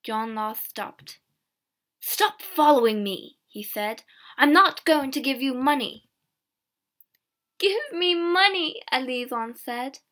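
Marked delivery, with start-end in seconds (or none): 2.0-3.4 annoyed rebuff. A narrator's reading. Peddler rebuffs begging girl. 4.4-6.1 annoyed refusal. A narrator's reading. Peddler refuses begging girl. 7.6-8.8 insistent demand. A narrator's reading. Girl demands money in quarrel.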